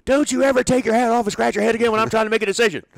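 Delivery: high, loud retold scolding; the manager chewing out his coach; mock scolding. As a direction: chew him out like an angry manager. Bobby scolding Leo.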